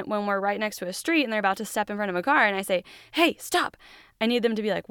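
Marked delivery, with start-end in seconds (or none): 3.1-3.8 urgent warning. Pretend. Child about to step into street.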